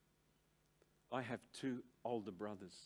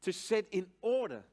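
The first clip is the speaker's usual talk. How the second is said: loud, raised, insistent sermon delivery; preaching at full force; 8 semitones higher, 10 dB louder than usual. Acted. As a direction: drive the definition home from the pulpit. Preacher emphasising a definition.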